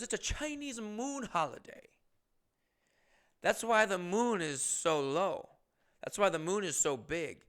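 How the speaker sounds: half-hearted mock Chinese accent. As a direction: quote her with a mild Chinese accent. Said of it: "does have a thick Chinese accent".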